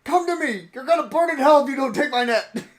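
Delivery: high, loud, laughing hellfire shout; over-the-top mock threat, cracking up; mock preacher yelling. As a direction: ham up a pushy preacher at the fish. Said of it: "and be like".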